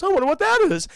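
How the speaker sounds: high, loud jittery dog voice; high mock-anxious wondering; nervous cartoon dog. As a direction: imitate a cowardly dog startled by a noise. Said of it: "He gets very scared".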